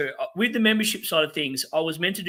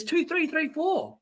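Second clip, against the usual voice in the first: much higher mocking sing-song count; mocking chat quote; 11 semitones higher, slower than usual. Streamer mocking a viewer's score, high and taunting. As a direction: echo the viewer's score back with scorn. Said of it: "and then he says".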